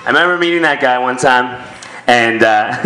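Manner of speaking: ordinary delivery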